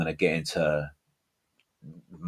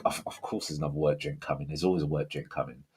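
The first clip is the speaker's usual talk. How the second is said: muttered aside; 3 semitones lower, 8 dB quieter, faster than usual. Acted. Groaning about endless work drinks.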